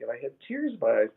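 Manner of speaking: ordinary talk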